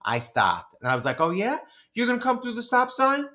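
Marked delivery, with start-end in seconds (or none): 1.2-3.3 high, taunting questions; challenging. Retold or imitated. Pedestrian challenging a driver at the stop sign, taunting. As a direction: dare the driver to try it. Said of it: "I was gonna challenge him".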